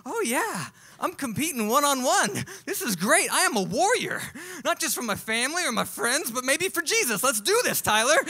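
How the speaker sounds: high, loud pumped-up boasting; a ten-year-old riding an adrenaline rush; hyped-up kid. Retold or imitated. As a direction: psych yourself up before the next punch. Kid hyped up mid-fight.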